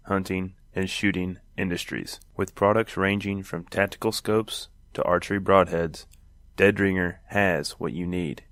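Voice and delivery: measured sponsor read with pauses between phrases. Acted. Host pitches hunting brand to listeners, measured ad read. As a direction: read it as a scripted commercial.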